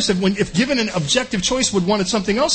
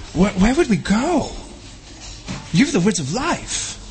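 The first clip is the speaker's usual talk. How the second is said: Peter's humble, sincere answer; earnest Peter; 2 semitones higher than usual. Retold or imitated. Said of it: "Peter's response".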